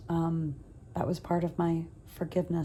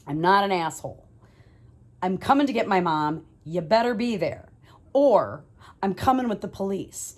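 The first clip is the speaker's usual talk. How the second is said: loud, threatening ultimatum; bent out of shape; 7 dB louder than usual. Retold or imitated. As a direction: lay down the law, loud. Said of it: "I am bent out of shape".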